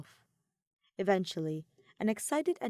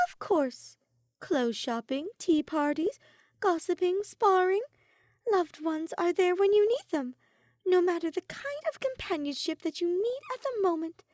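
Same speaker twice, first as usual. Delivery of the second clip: high, louder, climbing list; excited Soleil; 11 semitones higher, 5 dB louder than usual. A narrator's reading. Soleil listing fun times with Ophelia, high and excited. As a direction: build with each item.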